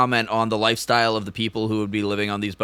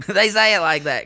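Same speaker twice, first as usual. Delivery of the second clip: higher, badly faked British accent sounding South African; accidental South African accent; 7 semitones higher, 3 dB louder than usual. Co-host defending his pronunciation, botched South African-sounding accent. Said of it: "South African".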